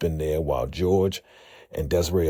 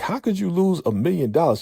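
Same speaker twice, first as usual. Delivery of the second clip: high, outraged demand; outraged boss; 13 semitones higher than usual. Retold or imitated. The boss confronting her over lost money, high and outraged. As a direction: demand the answer in disbelief.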